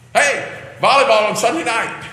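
high-pitched pretend excited invitation; put-on peppy announcement; mock enthusiasm. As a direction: call it out like an eager social organizer, hammed-up. Mocks a shallow idea of fellowship.

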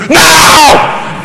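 very high, loud yell; acted screaming outburst; mock parental scream. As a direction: explode like a parent losing control. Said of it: "you're gonna resort to yelling and screaming".

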